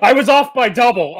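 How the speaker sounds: high, loud mocking outburst; mock incredulity. Friend mocks the other's excuse for bad maths, loud, high incredulity.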